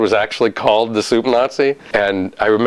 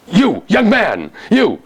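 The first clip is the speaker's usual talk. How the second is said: high, drawn-out indignant shout after someone; angry casting director; 10 semitones higher, slower than usual. Retold or imitated. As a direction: chase them out with high-pitched calls. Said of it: "this one casting director chased me out".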